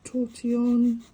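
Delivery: ordinary delivery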